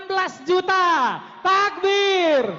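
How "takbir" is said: calling out. Real, not acted. Calls crowd to shout takbir.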